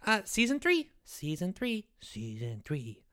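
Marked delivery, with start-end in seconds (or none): 0.1-0.9 bright, louder announcement of the news; excited reveal. Shown as a demonstration. Boy blurts out the big news to the listeners, excited. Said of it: "what about the announcement?". 2.0-3.0 much lower, softer and slow, dramatic; low slow reveal. Shown as a demonstration. Boy retries the announcement in a low dramatic voice. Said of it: "There you go".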